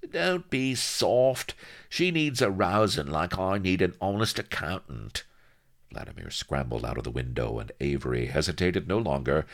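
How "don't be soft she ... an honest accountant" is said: higher-pitched, dismissive rogue; scoffing little shyster. A narrator's reading. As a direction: brush off his worry as a crook.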